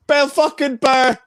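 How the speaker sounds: high, drawn-out comic impersonation; Bill Burr. Acted. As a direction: imitate his talk, slow, high and loud. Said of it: "you know the way he talks".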